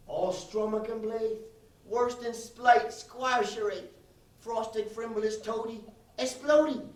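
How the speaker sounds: slow, solemn mock Gaelic limerick; fake Gaelic recital. Comic reciting a fake Gaelic limerick to the crowd, slowly. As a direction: recite nonsense words as if real Gaelic. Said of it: "a limerick in Irish Gaelic".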